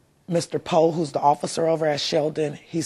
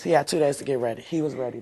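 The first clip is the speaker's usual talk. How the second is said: ordinary talk, nothing marked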